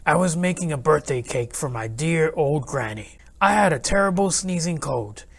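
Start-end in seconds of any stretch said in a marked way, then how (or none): none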